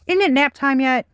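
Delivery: exasperated sigh. Real, not acted. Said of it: "They got me frustrated".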